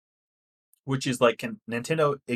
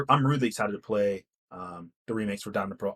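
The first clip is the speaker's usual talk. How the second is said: normal talking voice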